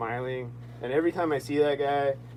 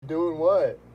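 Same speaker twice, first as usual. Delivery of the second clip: higher, louder mock innocent what; smirking innocence; 5 semitones higher, 4 dB louder, much slower than usual. Smirking driver, lightly voiced mock innocence. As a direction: play dumb with a smirk. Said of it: "he had this smirk".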